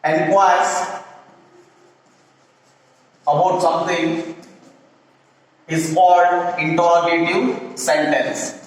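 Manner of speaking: spaced out, word by word with long gaps; slow dictation. Shown as a demonstration. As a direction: dictate it slowly so students can write. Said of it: "teacher dictating slowly with pauses".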